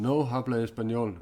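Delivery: loud, playful fake Spanish; loud teasing "No habla español"; mock Spanish reply. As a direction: toss it out as a teasing joke. Jokingly answering a chat viewer.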